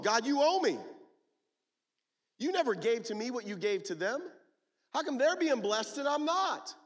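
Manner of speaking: high, loud, aggrieved rant; indignant complaint. Acted. Older brother to his father, loud resentful complaining rant. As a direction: whine out every grievance at full voice. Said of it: "look what he says here".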